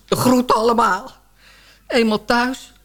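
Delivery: ordinary talk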